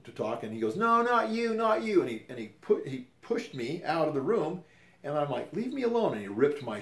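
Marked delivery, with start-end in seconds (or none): 0.8-2.0 much higher, louder put-on voice for the bully companion; hammed-up bully companion pushing him out; mocking sneer. Companion shutting him out of the room. 5.5-6.1 much higher, louder plea to be left alone; mock-upset protest at the bully companion; retold cry of protest. Being pushed out, shirt ripped.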